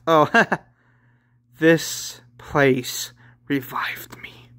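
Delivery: slow, creepy whisper with a small laugh; eerie whisper. Acted. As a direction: whisper it slowly like a spirit taking over a body. Possessing spirit speaks through Lazari.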